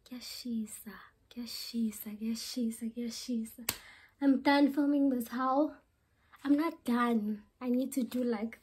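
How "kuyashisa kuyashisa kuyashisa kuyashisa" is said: soft whisper complaining of heat; whispered Zulu heat complaint. Real, not acted. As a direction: breathe it out, voiceless. Whispering it's hot.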